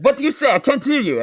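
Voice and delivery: a high, loud mock clerk demanding he repeat; mock impatient clerk. Hotel clerk to the masked guest, a loud mock snap. Clerk voiced in retold story.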